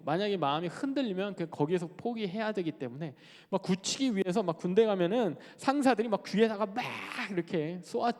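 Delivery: ordinary delivery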